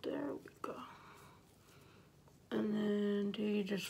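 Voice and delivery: lips covered by mask; through a sheet mask. Real, not acted. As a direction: fingers pulling at mouth. Seen 1: Left speaker's wet sheet mask covers lips; fingers adjust it at mouth.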